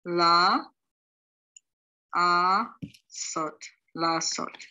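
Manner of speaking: la, a, sot said as separate syllables. Shown as a demonstration. Teacher sounding out syllables for students. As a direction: break la, a, sot apart slowly. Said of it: "The last word".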